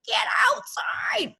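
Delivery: slow high breathy urging; whisper-cry the command like a ghostly spirit; inner spirit's call. Retold or imitated. Her inner spirit urging her outdoors, slow breathy high call. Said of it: "a spirit in me that's like".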